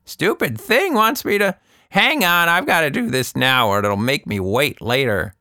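put-on high, fussy old woman's voice; an old lady grumbling at her phone; flustered old Anita. A narrator's reading. Anita grumbling at her phone game, in a high old woman's voice. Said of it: "started poking it".

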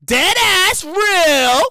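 very slow Brooklyn accent with ass stretched. Shown as a demonstration. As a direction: drag out dead ass real, Brooklyn style. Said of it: "that's my Brooklyn voice".

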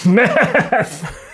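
METH blurted and drawn out; imitated show line. Quoting his favorite Trailer Park Boys line, drawn-out imitation. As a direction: stretch the word in a put-on voice. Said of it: "He says".

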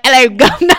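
loud, high phone greeting in mock British. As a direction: answer the call with a hammed-up British accent. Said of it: "I'll be like".

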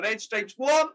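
high, indignant complaint; mock outraged customer. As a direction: whine like a picky customer. Mocking a customer complaining at bar.

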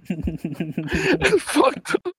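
high, soft words squeezed through laughter; laughing hard. Real, not acted. Host cracking up at a friend's joke, laughing hard. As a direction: barely get it out for laughing.

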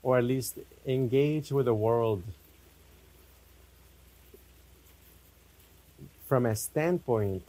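spacious, unhurried meditation guidance; guided meditation. Real, not acted. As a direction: lead them inward unhurriedly, phrase by phrase.

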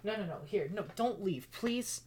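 desperate pleading. Acted. Maple One begs Maple not to walk out, desperate pleading. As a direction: beg in a small, hurried voice.

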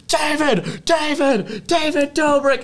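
high, loud shouted calls of his name; yelling his name. Retold or imitated. Teens yelling out a bus window at a star. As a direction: call out to him from the bus window. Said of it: "We go".

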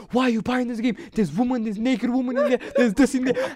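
high, scolding big-brother rant; older brother scolding the kid over the game; angry brother. Retold or imitated. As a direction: tell him off for buying the game. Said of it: "He's like".

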